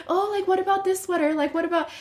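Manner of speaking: high, fast mocking imitation of a timid boyfriend; mocking tiptoeing boyfriend. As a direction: mimic him dodging the question in a squeaky, quick voice. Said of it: "don't just".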